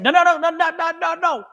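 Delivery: high, rapid-fire insistent yell; retold yelling. Spirit objecting to her ignoring the call, retold yelling. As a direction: imitate someone yelling you down. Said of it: "they're yelling at me".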